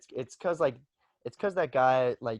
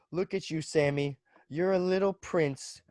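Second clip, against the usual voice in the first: high, slow, taunting sneer at his sister; mocking tease; 8 semitones higher, 6 dB louder, slower than usual. Teasing his sister for hiding at the farm, high slow mock sneer. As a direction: drag out a mocking jab. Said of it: "I was just like".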